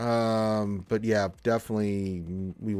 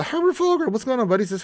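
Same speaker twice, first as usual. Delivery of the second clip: usual speaking voice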